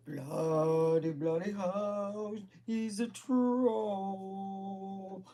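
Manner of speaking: very slow, drawn-out British dismay; appalled English uncle. Retold or imitated. Uncle on the phone about the boyfriend, mock horrified. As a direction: stretch each word in mock horror.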